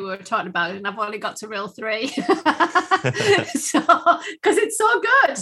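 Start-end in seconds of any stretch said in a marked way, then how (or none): 2.2-5.4 slow, high, laughing through the words; amused at herself. Real, not acted. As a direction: giggle it out. Laughing at own slow reading.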